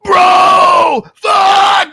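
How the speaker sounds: high, full-throated excited yell. Retold or imitated. Buddy phones with big news, yelling. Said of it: "He's, like, yelling at me".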